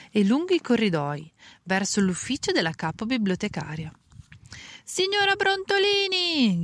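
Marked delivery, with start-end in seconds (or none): 4.8-6.6 long high call across the hall. A narrator's reading. Assistant calling the head librarian, drawn-out high call. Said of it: "gridò".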